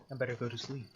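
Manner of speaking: ordinary talk, nothing marked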